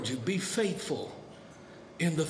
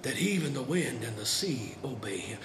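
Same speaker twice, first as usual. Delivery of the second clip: lower, softer, awestruck; amazed hush; 5 semitones lower, 6 dB quieter than usual. Retold or imitated. Believer after the storm, a quiet awestruck marvel. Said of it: "be amazed and go".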